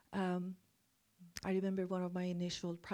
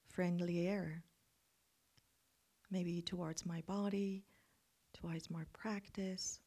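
guided meditation. Acted. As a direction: guide them into calm reflection.

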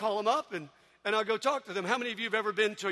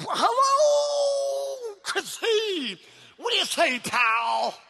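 Tigger voice; 11 semitones higher, 6 dB louder, much slower than usual. Retold or imitated. Tigger greeting on phone.

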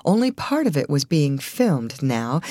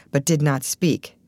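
ordinary delivery

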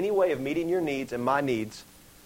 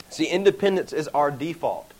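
ordinary delivery

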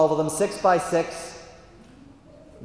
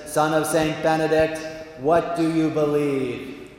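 measured, grand intoned question; God; slower than usual. Acted. God questions the Benedictine, slow and majestic. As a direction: stretch out the last phrase solemnly. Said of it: "that's how he talks".

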